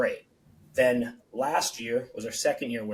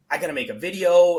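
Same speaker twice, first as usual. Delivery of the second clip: louder, higher burst of panic; panicked exclamation; 5 semitones higher, 9 dB louder than usual. Retold or imitated. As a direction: blurt it out in a rush of alarm. Retelling his own panic.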